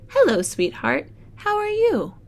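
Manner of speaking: sweet doting grandparent greeting; voicing Grandpa. Grandparents greet child in story.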